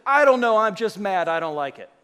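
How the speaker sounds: loud, petulant blurt fading out; a member answering the pastor, loud and sulky; mock sulky anger. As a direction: play the member who can't explain. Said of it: "And they say".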